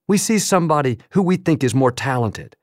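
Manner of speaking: ordinary talk, nothing marked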